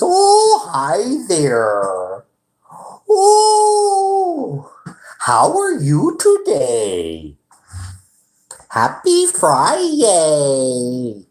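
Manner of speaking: very high, loud squealy fake-friendly greeting; imitating the manager. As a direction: parody the boss greeting customers. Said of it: "that's how he is".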